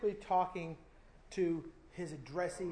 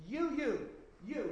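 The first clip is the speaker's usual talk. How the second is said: each "you" punched high and louder; emphatic repeated you; 7 semitones higher, 3 dB louder, slower than usual. Shown as a demonstration. Preacher hammering "you" at the congregation, emphatic. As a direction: jab the word "you" like a pointing finger. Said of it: "doubly emphatic".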